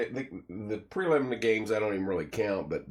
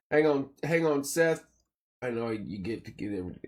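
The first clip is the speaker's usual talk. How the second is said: ordinary talk, nothing marked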